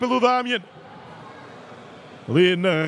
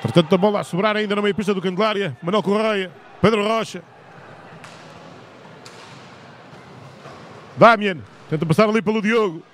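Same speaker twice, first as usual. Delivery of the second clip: live sports commentator calling action; play-by-play commentary. Real, not acted. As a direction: call the play as it happens.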